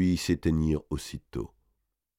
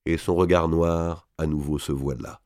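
ordinary delivery

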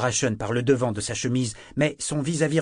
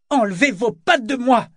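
loud, very high furious order; outraged cry; 12 semitones higher, 8 dB louder than usual. A narrator's reading. Althea grabbed by Kyle, shouting in outrage. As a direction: yell to get him off you. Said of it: "sans faire le moindre effort pour parler bas".

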